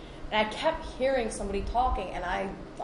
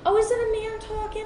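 put-on very high, loud old-lady question; high-pitched, eager retold question; imitating older lady; 14 semitones higher, 6 dB louder than usual. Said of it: "She goes".

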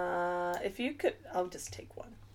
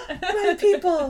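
high, loud, slow delighted cry; delighted gasp; 12 semitones higher, 8 dB louder, slower than usual. Retold or imitated. Meeting a bad-accent rival, a delighted high cry. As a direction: gasp and greet him like long-lost kin. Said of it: "And I was like".

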